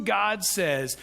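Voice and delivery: ordinary delivery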